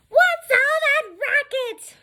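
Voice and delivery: very high, slow screech; mocked supervisor. Supervisor on the phone hearing noise, mocking high screech. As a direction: complain in a piercing falsetto caricature.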